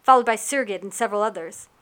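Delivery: ordinary delivery, nothing marked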